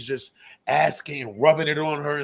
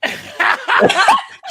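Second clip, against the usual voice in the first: loud high laugh bursting out; high burst of laughter; 8 dB louder than usual. Real, not acted. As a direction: crack up loudly.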